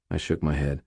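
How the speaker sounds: ordinary delivery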